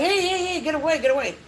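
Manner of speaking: high, hurried shooing; mock alarm. Retold shooing of licking puppy.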